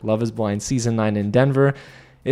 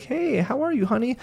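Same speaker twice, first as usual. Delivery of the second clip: very high, sweet mock greeting; sugary sweet fiancée; 12 semitones higher than usual. Madison sweetly greeting Joe, high mock coo. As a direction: coo at him like a doting fiancée.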